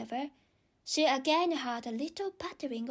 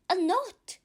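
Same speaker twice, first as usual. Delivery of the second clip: put-on young girl voice; 8 semitones higher, 5 dB louder than usual. Alice misunderstands eagerly.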